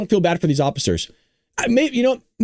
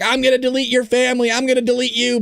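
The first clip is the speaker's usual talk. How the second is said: mock yell on a raised pitch; mock protester shouting; 8 semitones higher than usual. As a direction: imitate a heckler's shout at higher pitch. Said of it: "they shout derogatory and horrible and sometimes racist things".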